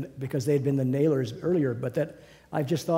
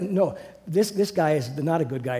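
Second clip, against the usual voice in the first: ordinary talk, nothing marked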